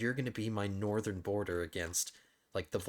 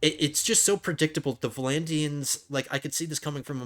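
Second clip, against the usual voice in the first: higher, louder exasperated complaint; 5 semitones higher, 6 dB louder than usual. Real, not acted. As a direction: vent at the obvious betrayal. Exasperated at predictable war.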